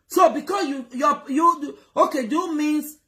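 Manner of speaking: heated shout. Real, not acted. Vlogger scolding diaspora viewers, heated shout. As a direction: raise your voice at them.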